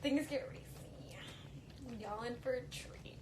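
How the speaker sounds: slow, soft, breathy tease of "Things get racy"; teasing whisper. Acted. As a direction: whisper it like a juicy secret. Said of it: "teasing about racy scenes".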